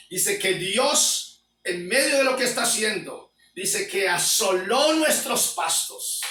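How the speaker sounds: loud, high, impassioned sermon voice; fiery preaching. Acted. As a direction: thunder the warning at the congregation. Preaching at full force.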